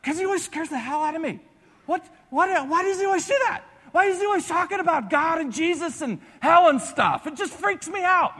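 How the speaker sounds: high, whiny mocking voice of a teammate. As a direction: whine it like a spooked jock. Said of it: "he's like".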